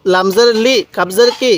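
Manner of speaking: ordinary talk, nothing marked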